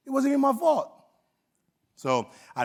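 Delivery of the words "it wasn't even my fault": put-on high, whining kid's protest; kid's whiny protest. Student to teacher over tack, high whine. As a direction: pitch it up like a kid sulking. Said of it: "I'm like".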